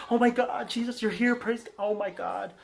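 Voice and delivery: mock-excited disciples greeting Jesus. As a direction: squeal it like thrilled fans. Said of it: "And everybody's like".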